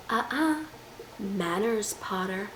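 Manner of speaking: soft, teasing mock scolding; teasing Draco. Vlogger mocks Draco's flirty threats at Harry, soft teasing. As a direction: play Draco softly toying with Harry.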